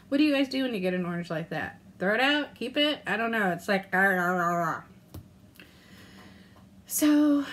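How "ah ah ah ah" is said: strained little grunts of effort; mock effort grunts. Real, not acted. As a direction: grunt as if peeling a stubborn orange. Struggling to peel orange.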